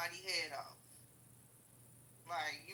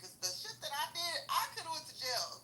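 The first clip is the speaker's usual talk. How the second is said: laughing as she admits it, higher; 7 semitones higher than usual. Real, not acted. To her viewers, laughing while confessing she could've gone to jail. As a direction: talks through a laugh about the jail risk.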